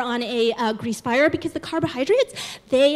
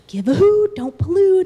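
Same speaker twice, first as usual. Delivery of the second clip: mock PSA owl, higher and louder; Hootie the Owl; 4 semitones higher, 6 dB louder than usual. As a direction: chirp the slogan like a cartoon mascot. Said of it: "Hootie the Owl comes flying in and says".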